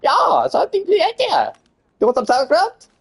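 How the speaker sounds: put-on movie villain German accent. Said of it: "I could do a German accent".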